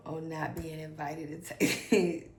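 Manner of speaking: normal talking voice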